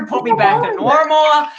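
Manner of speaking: mock-pleading patient rushing to the doctor; begging. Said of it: "begging the doctor".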